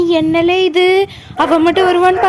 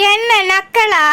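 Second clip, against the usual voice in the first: usual speaking voice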